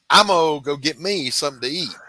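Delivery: lightly voiced Georgia slang; imitating Georgia speech. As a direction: mimic the locals, clipping "I'm-o". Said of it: "I heard shit I'd never heard before".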